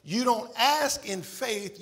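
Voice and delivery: normal talking voice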